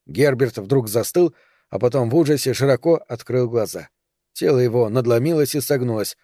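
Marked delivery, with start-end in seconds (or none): none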